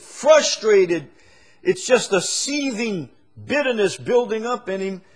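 high, loud preaching cadence; full-force preaching. Acted. Preacher to congregation on rude bosses, full-force preaching.

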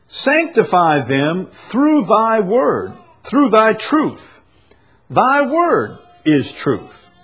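slow, high proclamation of scripture; emphatic preaching. Acted. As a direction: draw out each phrase for the congregation.

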